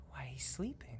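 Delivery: hushed unvoiced whisper, dropping away; whispering ghost maid. Ghost maid hushing the player by the crib. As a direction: shush them like a ghostly nanny.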